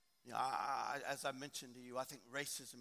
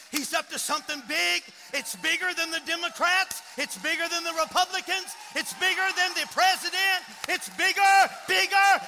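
high, loud shout building to a peak; full-force preaching; 14 semitones higher, 19 dB louder than usual. Real, not acted. Preacher declares revival to the church, shouted climax. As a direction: build each phrase to a roaring climax.

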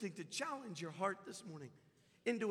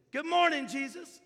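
much higher, much louder bright greeting; cheerful old lady; 10 semitones higher, 15 dB louder, slower than usual. Retold or imitated. Old neighbour Rosie greeting her picture of Jesus, cheerful high voice. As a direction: sing out the morning hello to Jesus.

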